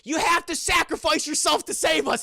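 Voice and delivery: loud, high, urgent plea; hammed-up movie cry for help; mock calling out. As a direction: call to Eddie as if dramatically begging. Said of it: "he's calling Eddie".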